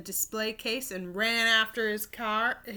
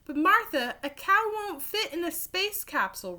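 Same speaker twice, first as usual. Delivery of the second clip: cried out; 8 semitones higher than usual. Acted. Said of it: "Rob cried".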